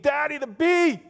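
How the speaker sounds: high, loud imitation of a panicked child; screaming little girl. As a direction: shriek it like a frightened girl. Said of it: "she's screaming in the back seat".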